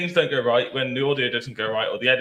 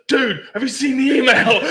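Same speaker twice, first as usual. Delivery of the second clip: much higher, louder, laughing disbelief; excited retelling; 13 semitones higher, 8 dB louder than usual. Retold or imitated. Co-host retells his friend's excitement, higher, louder, laughing. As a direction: burst in with giddy news. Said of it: "and he's like".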